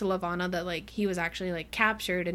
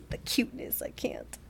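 whispered, voiceless swoon; soft, unvoiced gushing; overwhelmed by cuteness; 2 semitones higher, 6 dB quieter than usual. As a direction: barely get it out for cuteness. Overcome by how cute it is.

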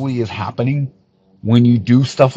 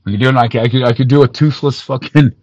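ordinary talk, nothing marked

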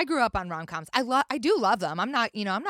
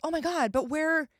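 higher, mock-dismayed younger self; college-age self; 7 semitones higher than usual. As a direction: lightly act out a wounded, expectant student. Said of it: "would be like".

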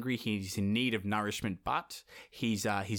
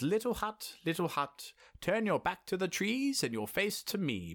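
lightly voiced chant to hut; high singsong plea; 8 semitones higher than usual. Ivan addresses the hut.

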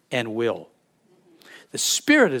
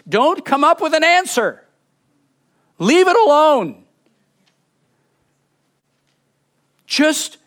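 higher, louder preaching push; forceful exhortation; 10 semitones higher, 4 dB louder than usual. Real, not acted. Preacher urging the congregation to stop seeking answers, forceful. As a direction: drive the command home.

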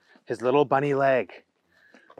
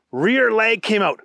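usual speaking voice

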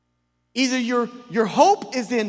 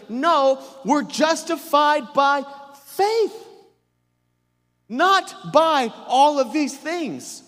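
firm, emphatic correction, slow and higher; Paul interjecting; 5 semitones higher, 4 dB louder, slower than usual. Retold or imitated. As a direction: cut in firmly, stretching out each key word. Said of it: "And Paul is interjecting".

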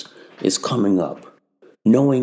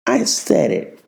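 ordinary talk, nothing marked

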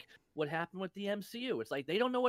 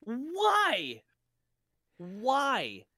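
high, louder exasperated "why"; exasperated questioning; 7 semitones higher, 5 dB louder than usual. Real, not acted. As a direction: demand an explanation, pitched up in disbelief. Ranting at a plot decision he hates.

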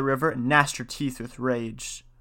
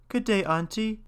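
put-on girl's voice; 8 semitones higher, 3 dB quieter than usual. Girl greets Baba Yaga.

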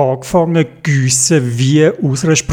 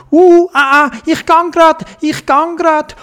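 much higher squawking, anxious; nervous Dodo; 13 semitones higher, 4 dB louder than usual. A narrator's reading. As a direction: flap and fret like a worried bird. Dodo answers before running. (nervöser, hoher Vogelruf)

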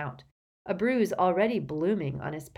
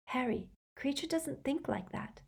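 put-on soft, higher-pitched girl's voice; tearful and gentle; 9 semitones higher, 8 dB quieter than usual. A narrator's reading. Girl defends the elf to her friend, soft and tearful. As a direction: gently correct him, softly. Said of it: "wiping her eyes".

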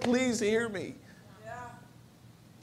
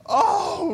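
high sudden cry of oh; 11 semitones higher, 4 dB louder than usual. Retold or imitated. Crying out to the Lord from the next room. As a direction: wail from the other room. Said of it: "hear from the other room".

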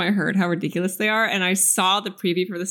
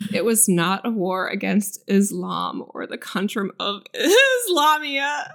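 laughing through; slower than usual. Real, not acted. Reading a Bush quote aloud while cracking up. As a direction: fight the giggles and lose on the last word. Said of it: "really trying right now".

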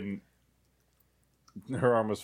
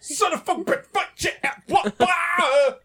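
high garbled swearing that breaks into a wail; mock cursing run together into wailing; breakless cursing; 14 semitones higher, 6 dB louder, slower than usual. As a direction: imitate curses melting into a loud "wah". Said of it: "words require, like, a break".

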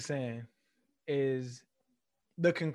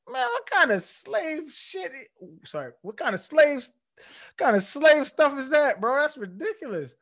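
incredulous outrage; 11 semitones higher, 5 dB louder than usual. Retold or imitated. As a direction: scoff at the rule as absurd. Outraged reply to sir rule.

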